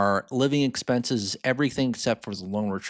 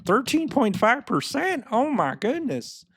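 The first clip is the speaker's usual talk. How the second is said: much higher, mock-shocked sarcastic gasp over the rate; sarcastic Dave Ramsey; 13 semitones higher, 4 dB louder than usual. Teacher mocks a debt guru's sarcastic shock, high and put-on. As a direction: play a radio money host faking horror at the number. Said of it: "my Dave Ramsey imitation voice, but when he is sarcastic".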